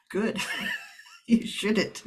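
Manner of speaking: laughing through the words. Real, not acted. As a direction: chuckle while agreeing. Player laughs at GM remark.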